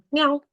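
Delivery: high playful meow to the class; cat meow. Shown as a demonstration. Teacher meows for her students, high and playful. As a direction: meow like a kitten for the kids. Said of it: "draw a little cat".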